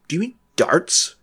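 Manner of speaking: darts with a clear R, said as tɑɹts; an English friend asks, R pronounced. Retold or imitated. English friend checking the word, English accent. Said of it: "one of them says".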